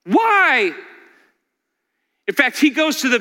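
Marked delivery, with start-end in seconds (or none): 0.0-0.8 anguished cry. Acted. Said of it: "every human heart screams out".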